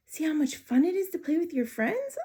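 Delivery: high little hamster asking a friend; small high voice, curious and hopeful; squeaky hamster voice. Acted. Hamster Humphrey talks to Og.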